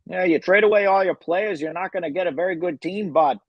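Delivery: put-on ribbing buddy in Canadian accent. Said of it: "doing his Canadian accent for too long".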